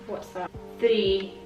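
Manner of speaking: normal talking voice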